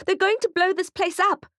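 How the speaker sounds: woman screaming in Kate's face; panicked scream. A narrator's reading. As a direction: warn everyone in terror. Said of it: "screams a woman".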